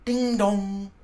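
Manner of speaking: ringing "Ding dong" like a clock bell; bell chime. A narrator's reading. Narrator sounding the half-hour chime.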